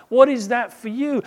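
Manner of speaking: high, loud push on "What" and "you"; emphatic challenge. Shown as a demonstration. Preacher pressing Jesus's question on listeners, emphatic. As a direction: press the question home forcefully. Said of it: "I love that emphasis".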